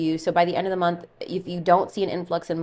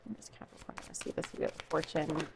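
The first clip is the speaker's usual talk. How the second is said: near-whispered, much softer question; hushed repeat; 2 semitones lower, 15 dB quieter than usual. Real, not acted. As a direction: murmur the question to the cards, barely voiced.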